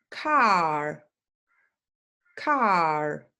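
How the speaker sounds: car with an open ah vowel; clear car. Shown as a demonstration. Teacher modelling car against care. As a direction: drop the jaw for the ah in car. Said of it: "The next one is".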